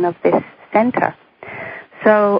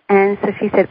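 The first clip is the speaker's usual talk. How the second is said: usual speaking voice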